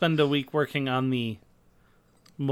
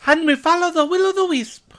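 high, louder fairy-tale sing-song; whimsical high voice; 5 dB louder than usual. Pretend. Friend joking about fantasy ferries, whimsical high voice.

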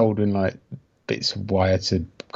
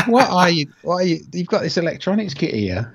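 higher-pitched, exasperated dad quote; retold parental disbelief; 7 semitones higher than usual. Dad to lad soldering pasta with new kit, retold disbelief. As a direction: imitate yourself questioning your lad in disbelief. Retelling telling off his lad.

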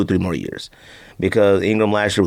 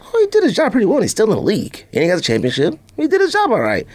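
emphatic high retort; 10 semitones higher than usual. Acted. Insisting sarcastically, high pitch.

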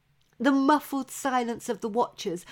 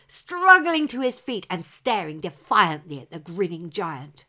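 plain reading voice

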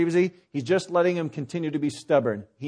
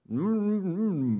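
closed-mouth humming mumble, softer; unintelligible mumble; 2 semitones higher, 8 dB quieter, much slower than usual. Acted. Fisherman answering how he catches fish, unintelligible mumble. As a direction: mumble wordlessly through a shut, full mouth.

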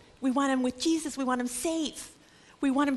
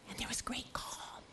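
a whispered sense of calm; whispered hush; 16 dB quieter than usual. A narrator's reading. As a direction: breathe it out in a whisper. Storm stilled, calm narrated.

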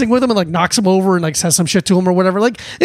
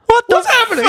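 laughing confusion. Retold or imitated. Retold confused reaction.

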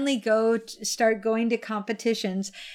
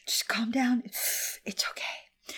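hushing whisper; much slower than usual. Real, not acted. Whispering to a giggling friend to calm down. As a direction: hush her gently, barely voiced. Calming her laughing friend.

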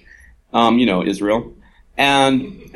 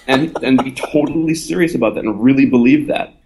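ordinary delivery

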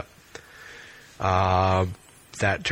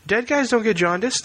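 incredulous; 14 semitones higher than usual. Real, not acted. Incredulous retort.

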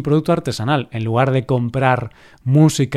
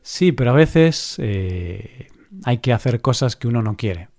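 ordinary delivery

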